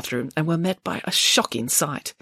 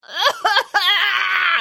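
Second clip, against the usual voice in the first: wailing sob; 13 semitones higher, 3 dB louder than usual. Acted. Boris sobbing behind locked door.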